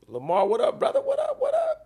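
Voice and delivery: high, loud hype shout-out; squealed greeting to a viewer; excited greeting. Real, not acted. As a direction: call out to a friend with big energy.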